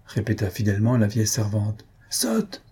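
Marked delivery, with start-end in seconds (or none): 2.1-2.6 a sharp, high exasperated shout; high exasperated shout at the old maid; exasperated cry. A narrator's reading. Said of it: "cria son maître exaspéré".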